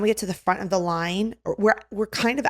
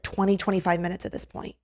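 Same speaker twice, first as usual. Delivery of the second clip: ordinary talk, nothing marked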